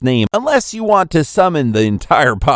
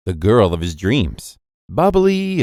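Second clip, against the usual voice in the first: ordinary delivery, nothing marked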